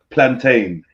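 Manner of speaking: plantain with a crisp p and long ain; careful plantain. Shown as a demonstration. Showing the correct way to say the word, careful. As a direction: say plantain, every sound clean. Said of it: "I'll give you an example".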